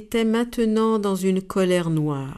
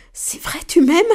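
higher and louder put-on voice; bashful delight; 9 semitones higher, 6 dB louder than usual. The blushing skunk answers the dove, higher and delighted. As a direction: blush through the question. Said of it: "La moufette rougit".

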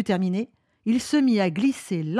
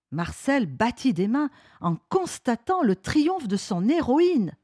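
plain reading voice

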